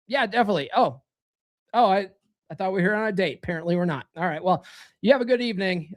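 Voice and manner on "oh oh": ordinary delivery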